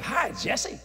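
high-pitched friendly hello; angel's cheery greeting. Retold or imitated. Angel greeting the preacher, high friendly hello. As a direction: answer in a high, chirpy call.

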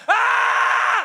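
very high loud scream; child's scream. Retold or imitated. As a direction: let out a high shriek like a furious kid. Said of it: "he just went".

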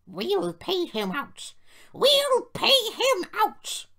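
slow, high threat building to a shriek; drawn-out squealed threat from offended coaches; vengeful grumbling. A narrator's reading. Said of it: "grumbled the coaches".

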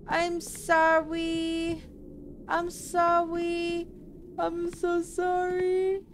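high, slow, drawn-out whimpering sorry; pleading apology. Acted. Player begs the game agents not to punish him, high drawn-out wailing. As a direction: beg forgiveness in a high wail. Begging after killing game character.

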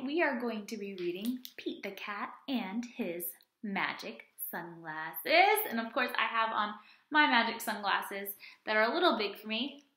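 ordinary delivery

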